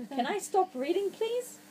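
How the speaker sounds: beg, whining upward; mock pleading. Reader begging the child to stop, whiny plea.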